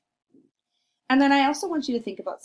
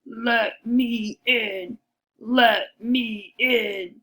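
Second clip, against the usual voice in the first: slow, louder wolf growl; big bad wolf; 4 dB louder, much slower than usual. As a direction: loom at the door and demand it slowly. Wolf demands entry.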